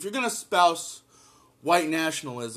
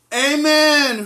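much higher, louder exclaimed "Amen!"; excited amen; 9 semitones higher, 9 dB louder than usual. Real, not acted. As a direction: shout it like agreeing in church. Cheering the article's ending.

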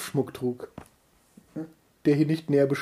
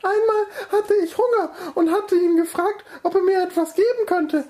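much higher, comic storyteller voice; high character voice; 13 semitones higher than usual. Acted. Villager praises the mayor in a high voice. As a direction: pipe it up high like a simple villager.

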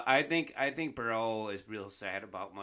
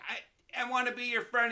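put-on little kid voice; 11 semitones higher, 4 dB louder than usual. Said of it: "He's like, he's like".

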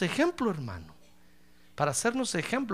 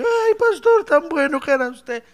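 high, loud hammed-up lament; mock graveside wailing for the dead pastor; mock mourning wail; 9 semitones higher, 8 dB louder than usual. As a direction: wail like a hired mourner, in jest. Mourner weeping at the cemetery.

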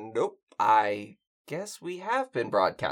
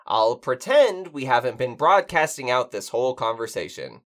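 ordinary delivery

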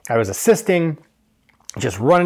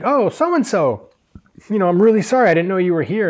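sweet apology; 6 semitones higher, 3 dB louder than usual. Retold or imitated. Stylist apologizing to his waiting client, high and gushing.

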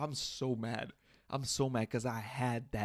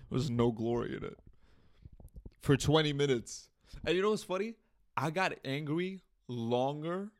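normal talking voice

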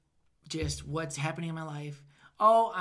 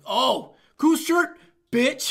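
higher, louder, slow sarcastic sneer; mock sneer; 6 semitones higher, 9 dB louder, much slower than usual. Comic roasting a guy's stupid shirt, slow sarcastic sneer. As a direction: drag out fake praise, then cut him.

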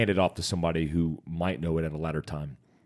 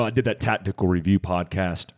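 usual speaking voice

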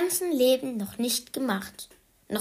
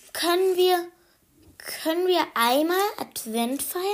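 lightly voiced small boy, higher; child asking; 7 semitones higher than usual. Anton asks his mother, a hint of a little boy. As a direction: plead gently like a toddler.

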